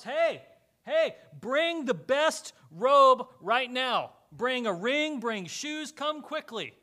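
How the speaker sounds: loud, high, slow call to the servants. Acted. Father calling back to the servants at the house, loud and urgent. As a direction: shout it up to the house, eager.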